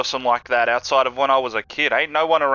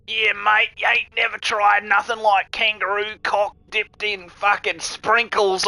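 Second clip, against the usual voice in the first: high, hammed-up ocker bloke selling bush tucker; Aussie bloke impression; 8 semitones higher than usual. Mocking foreign view of Aussie food, hammed-up ocker voice. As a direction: play the over-the-top Aussie larrikin, drawn out on the sprinkles. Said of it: "some dude going".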